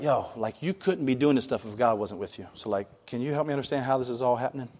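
soft, low hushed asking; sneaky Nicodemus. Retold or imitated. Nicodemus sneaking to Jesus at night, asking softly. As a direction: ask quietly so nobody hears. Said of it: "And he kinda asked him, like".

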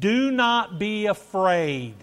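slow, high, loud declaration of scripture; preached proclamation. Acted. Preacher delivers Christ's words to the congregation, proclaiming loudly.

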